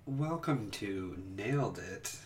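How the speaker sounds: slow, hushed show intro; very quiet. Acted. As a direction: murmur the welcome slowly. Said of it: "That was way too subtle".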